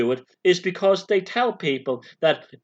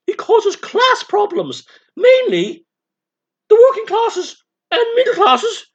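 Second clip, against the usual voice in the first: very high, loud caricature aristocrat; hammed-up socialist toff, squealed in protest; Lord Monty; 7 dB louder than usual. Socialist lord declaring himself against Trump, high hammed-up protest. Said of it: "And, and me as Monty".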